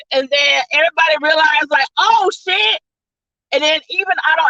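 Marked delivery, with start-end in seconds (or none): 2.0-2.8 shocked gasp. Retold or imitated. Everybody realizes Goblin's betrayal.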